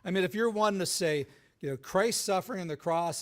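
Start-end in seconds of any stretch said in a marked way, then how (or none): none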